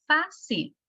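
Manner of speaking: "fácil" as FA-cil, high first syllable then falling; stress on FA. Shown as a demonstration. Teacher showing the tonic syllable, "fácil" stressed high on FA. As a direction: pitch up FA in "fácil", drop on cil. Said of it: "su sílaba tónica en fa".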